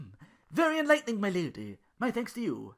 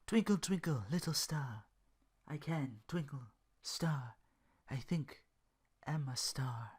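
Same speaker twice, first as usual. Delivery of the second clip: soft whispered sleepy mumble, slowing at the end; sleep mumbling; 3 dB quieter, slower than usual. Acted. Duke mumbling in his sleep about becoming a star, soft and whispered. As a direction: murmur it half asleep. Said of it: "He began to mumble in his sleep".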